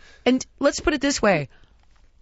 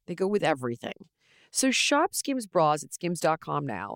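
softer, upbeat sponsor read; 5 semitones lower, 9 dB quieter than usual. Acted. Host reads sponsor ad to listeners, softly. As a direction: sell the underwear warmly.

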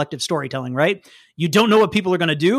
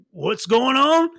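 higher, just woken confusion; groggy Werebear; 7 semitones higher, slower than usual. Acted. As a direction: blurt it half awake. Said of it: "He kinda got up and he's like".

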